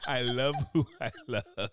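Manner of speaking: slow, through laughter; laughing repeat. Retold or imitated. Repeating the mocked slogan while laughing. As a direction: let the laugh break into the words.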